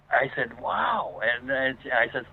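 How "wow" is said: high-pitched retold wow; acted-out astonished reaction; amazed. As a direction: let the pitch leap in mock amazement. Retold reaction to neighbor's account.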